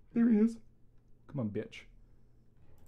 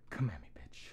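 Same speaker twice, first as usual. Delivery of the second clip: low hushed whisper; playful whispered challenge; whispered taunt; 9 semitones lower, 14 dB quieter, faster than usual. Pretend. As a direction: mutter the dare under your breath.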